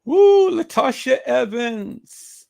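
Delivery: high, loud, stretched-out name call; gloating taunt. Real, not acted. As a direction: crow the name slowly to taunt her. Gloating over exposed name.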